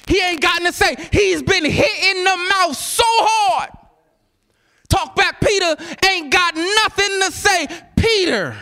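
high, emphatic preacher's shout; impassioned sermon cry; full-force preaching. Real, not acted. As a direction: hammer it at the congregation, high and hard.

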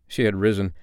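ordinary delivery, nothing marked

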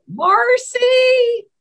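high, loud call down the hall; calling a name. Retold or imitated. Justice summons her clerk from down the hall, high loud call. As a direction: sing out the name from another room.

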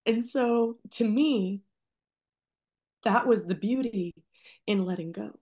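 holding back tears while she speaks; getting emotional. Real, not acted. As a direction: voice high and tight with real emotion, near tears. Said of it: "oh, I'm getting emotional".

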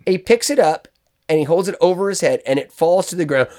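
usual speaking voice

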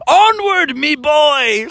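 Bubby charging with his sword; pirate-ish rally. Acted.

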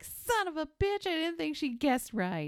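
everybody cursing that she guessed right; shocked crowd. Retold or imitated. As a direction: squeak it out like sore losers. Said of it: "everybody's all like".

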